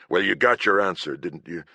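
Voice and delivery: ordinary delivery, nothing marked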